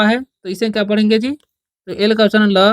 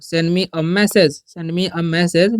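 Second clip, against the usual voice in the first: slow dictation; much slower than usual. Shown as a demonstration. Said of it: "तो बोलना चाहेंगे".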